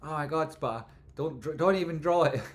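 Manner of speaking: hammed-up panicked plea; mock panic. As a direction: plead in fake alarm. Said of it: "it's like".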